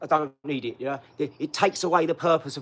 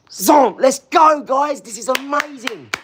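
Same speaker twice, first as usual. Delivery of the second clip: loud, high shout, stretching out at the end; excited hype shout; 9 semitones higher, 9 dB louder, slower than usual. Real, not acted. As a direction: holler to rally the viewers. Hyping up the stream audience.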